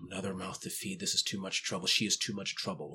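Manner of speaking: low, hushed inner voice; soft, low muttered thoughts of worn-out parents; weary parents' thoughts. A narrator's reading. Game master voices parents' thoughts about their daughter, hushed.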